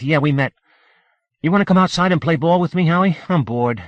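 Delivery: put-on high, quick young boy; eager kid, high and fast; little boy voice. A narrator's reading. Benny voiced by narrator.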